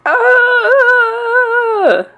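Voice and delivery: high, loud, wavering scream; man screaming in fright, held long; frightened yell. A narrator's reading. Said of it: "The man yelled".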